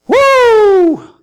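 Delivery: high, loud mock whoop of a coaster ride; roller coaster whoop. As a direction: whoop like riding the drops.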